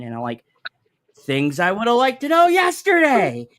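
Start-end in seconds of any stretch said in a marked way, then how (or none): 1.2-3.5 dry sarcastic jab, a bit higher; sarcastic retort. Retold or imitated. Dad answering his daughter's late schedule news, sarcastic. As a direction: needle her with sarcasm. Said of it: "I said it sarcastically".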